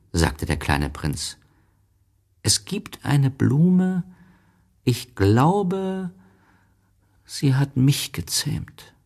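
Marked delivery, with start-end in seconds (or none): 2.4-8.9 put-on high, slow-starting child voice; voiced little prince, hesitant pauses; pensive little prince. A narrator's reading. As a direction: think it out in a high boyish voice. Little prince, thinking of flower.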